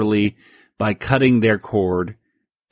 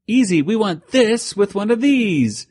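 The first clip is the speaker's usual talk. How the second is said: pitched up, mischievous cartoon kid; 10 semitones higher than usual. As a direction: play the bratty toon, high. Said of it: "And he says".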